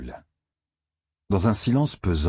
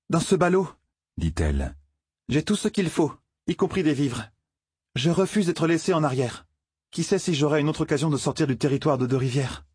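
much higher voice, stubborn and defiant; defiant young woman; 8 semitones higher than usual. A narrator's reading. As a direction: stand your ground as the girl. Egwene defiant lines. (jeune femme têtue et provocante)

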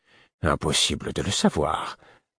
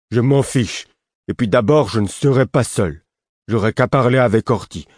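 loud, higher-pitched sulky comeback; petulant, raised-voice defiance; childish defiance; 5 semitones higher, 6 dB louder than usual. A narrator's reading. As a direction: answer back like a stubborn child. Lourd defiantly answers the prince.

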